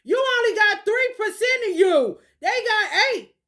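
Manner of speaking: loud and high; heated. Real, not acted. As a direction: push it up, heated. Heated rant.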